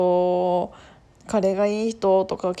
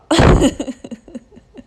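bursting laugh; 7 semitones higher, 8 dB louder than usual. Real, not acted. Laughs at her own diary.

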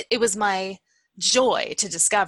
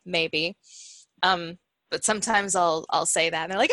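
normal talking voice

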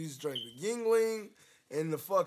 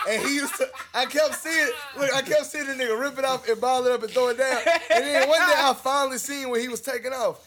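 higher and louder, laughing as you talk; laughing through story; 6 semitones higher, 8 dB louder than usual. Real, not acted. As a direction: tell it while cracking up. Cracking up retelling the story.

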